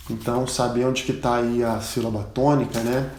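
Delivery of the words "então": normal talking voice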